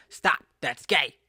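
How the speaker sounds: loud, emphatic comedian's command; Kevin Hart impression. Comedian mimicking a dad scolding his son, loud and slow. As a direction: shout it slowly like a stand-up comic. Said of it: "that's how he would say it".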